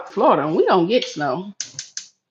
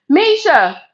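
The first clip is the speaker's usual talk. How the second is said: loud call across the house; shouting for her daughter; 9 semitones higher, 4 dB louder than usual. Real, not acted. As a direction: call her name to another room. Calling daughter from kitchen.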